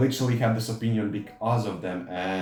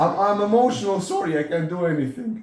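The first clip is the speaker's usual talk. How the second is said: higher, louder whiny mock voice; pleading mock whimper, pitched up; mock helpless whiner; 9 semitones higher, 5 dB louder than usual. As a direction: play the useless emotional guy for laughs. Mocking a helpless emotional man.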